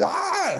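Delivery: high-pitched mock scream of panic; a short high yelp of labor panic; retold scream.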